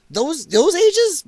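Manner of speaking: high, loud and slow in disbelief; incredulous. Real, not acted. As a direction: stretch it out, appalled. Disbelief at the video claim.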